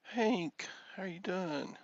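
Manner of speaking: high sing-song welcome; high greeting. Real, not acted. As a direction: pitch up delighted to see him. Greeting viewer.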